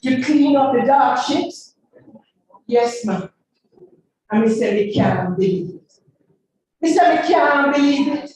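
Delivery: rhythmic patois recitation; dub poem. Acted. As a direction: chant it to the beat.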